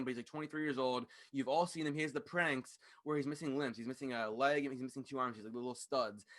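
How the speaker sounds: ordinary talk, nothing marked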